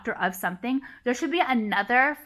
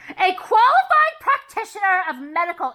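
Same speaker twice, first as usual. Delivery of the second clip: higher, louder mock recital of the definition; loud, higher triumphant read-out of the dictionary line; reading out, raised; 9 semitones higher, 7 dB louder than usual. Real, not acted. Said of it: "Doctor meaning".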